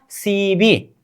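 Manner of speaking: "सी बी" with a clear b in the second part; syllable pronunciation. Shown as a demonstration. Teacher joins the two sounds for students, "सी बी" pronounced see-bee. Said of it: "ब plus ई क्या होगा".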